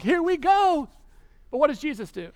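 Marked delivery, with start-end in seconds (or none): 0.0-0.9 high, loud burst of excitement; hopeful excitement. Acted. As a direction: exclaim it with hopes soaring. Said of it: "get their hopes up".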